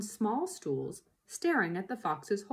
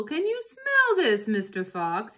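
shouted; 2 semitones higher, 7 dB louder than usual. Acted. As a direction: call it down the hole to tease. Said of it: "he shouted".

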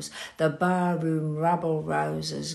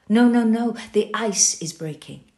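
high, loud cry of denial; frantic shouted reassurance; desperate outcry; 4 semitones higher, 10 dB louder than usual. Acted. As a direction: cry out to drown the fear.

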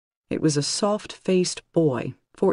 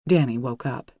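ordinary delivery, nothing marked

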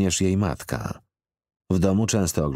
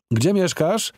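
raised, higher calling voice; calling after; 13 semitones higher, 4 dB louder, slower than usual. A narrator's reading. Man calls after the girl crossing the street, raising his voice. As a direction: call out after someone leaving. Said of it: "zawołał za nią".

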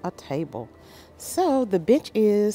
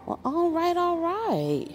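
delighted; 11 semitones higher than usual. Real, not acted. Delighted at a good deal.

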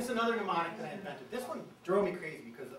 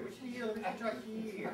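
rapid house finch mnemonic; said really fast; 4 semitones higher, faster than usual. Shown as a demonstration. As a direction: rattle the mnemonic off quickly. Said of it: "So you gotta say it really fast".

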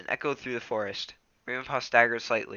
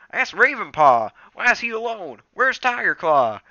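much higher, louder alarmed cry; startled and worried; shocked gasp; 10 semitones higher, 10 dB louder than usual. Acted. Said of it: "Graypaw gasped".

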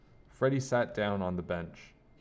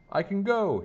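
high, louder happy shout; 11 semitones higher, 5 dB louder than usual. A narrator's reading. Freddie running out of the house to his friends, shouting. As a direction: call it out high and loud. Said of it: "he shouted".

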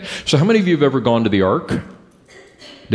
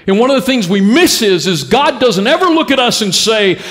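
raised, ringing preacher's voice; preaching at full force; 8 semitones higher, 3 dB louder than usual. Acted. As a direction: drive it home to the room, louder and higher. Preaching Nehemiah's plea.

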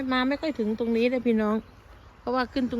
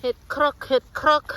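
calling out เห็ดครอก louder and higher, thrilled; excited mushroom call; 5 semitones higher, 6 dB louder than usual. Real, not acted. Vlogger spots mushrooms, calls to viewers, excitedly. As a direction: announce the find with real delight.

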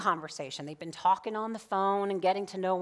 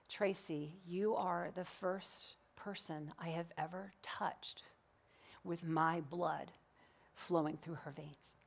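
softer, slow near-whisper; hushed, moved; 8 dB quieter, slower than usual. Retold or imitated. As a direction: breathe it out tenderly in awe. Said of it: "and he said".

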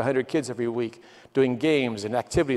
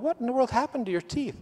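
shocked; 10 semitones higher, 4 dB quieter than usual. Retold or imitated. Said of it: "I'm going".

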